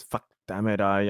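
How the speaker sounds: ordinary talk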